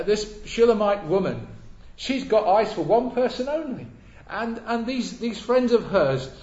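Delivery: loud, emphatic sermon delivery; forceful pulpit voice; preaching. Real, not acted. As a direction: preach it out loudly to the congregation.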